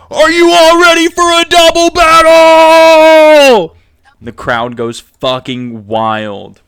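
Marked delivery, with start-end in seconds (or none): 0.1-3.8 high, very loud, slow ring call; announcer's roar. Acted. Announcer hyping the crowd for a double battle, stretched-out scream. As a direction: roar to the crowd, holding the final word. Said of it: "and the announcer is like".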